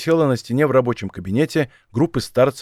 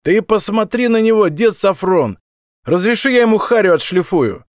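high, loud, heated outburst; angry young thug; 7 semitones higher, 5 dB louder than usual. A narrator's reading. Young thug asks the old boss to let him beat the captive, angry. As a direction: flare up and demand a go at him. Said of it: "Юнец подпрыгнул от злости".

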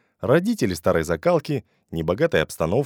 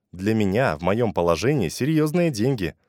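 ordinary delivery, nothing marked

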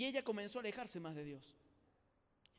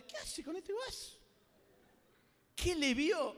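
mocking onlooker; 6 semitones higher, 4 dB louder, slower than usual. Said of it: "la miraba la chica".

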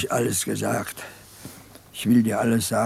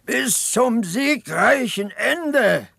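much higher, drawn-out hammed-up slogan; exaggerated mock orator's cry, very slow and high; mock shrill rallying cry; 10 semitones higher, much slower than usual. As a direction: ape the boy on the barrel, stretching every word. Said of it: "und schreit immer".

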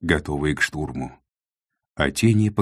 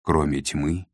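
ordinary delivery

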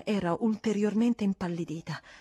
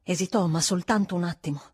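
ordinary delivery, nothing marked

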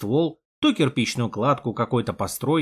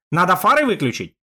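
shouting; 4 semitones higher, 4 dB louder than usual. Acted. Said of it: "перекрикивая завывание мотора".

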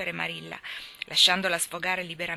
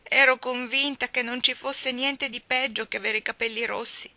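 despairing lament; 4 semitones higher, 4 dB louder than usual. Acted. Anna laments her green hair.

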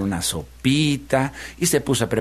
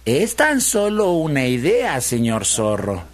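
slow, singled-out words, pitched up then dropping; coaxing piglet; slower than usual. Acted. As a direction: offer it innocently, stretching the words. (cerdito zalamero, alargando las palabras)

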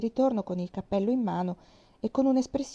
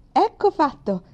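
higher and much louder, triumphant; exultant; 7 semitones higher, 10 dB louder than usual. A narrator's reading. Girl finishing her aunt's makeover, exultant. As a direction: crow it like a job well done. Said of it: "disse esultante".

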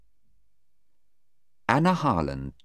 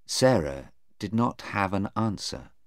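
plain reading voice

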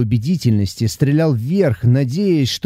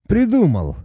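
exclaiming; 7 semitones higher than usual. A narrator's reading. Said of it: "воскликнул Ломонос".

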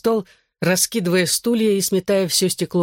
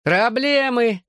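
higher, drawn-out mocking echo; mirthless mockery; 7 semitones higher, much slower than usual. A narrator's reading. Brawler echoes the barmaid's word, mirthless mock. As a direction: throw the word back without mirth. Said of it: "невесело рассмеялся Гипси".

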